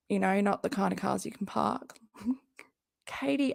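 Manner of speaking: usual speaking voice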